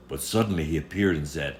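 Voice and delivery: usual speaking voice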